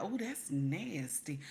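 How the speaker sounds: ordinary talk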